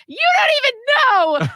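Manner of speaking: high, full-blown upset shout; re-enacted yelling. Upset speaker yelling back at their person, yelling. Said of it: "I'm yelling at him".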